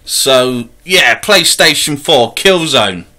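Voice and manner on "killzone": usual speaking voice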